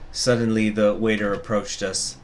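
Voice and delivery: normal talking voice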